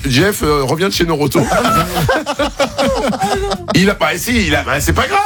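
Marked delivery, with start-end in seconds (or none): 1.5-3.7 long high laugh; real laughter. Real, not acted. Host teasing Jeff about a car garage, real high laughter. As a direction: crack up, pitch leaping high.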